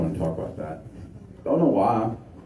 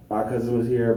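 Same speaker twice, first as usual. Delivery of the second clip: normal talking voice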